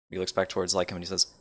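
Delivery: ordinary delivery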